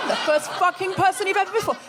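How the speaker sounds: ordinary delivery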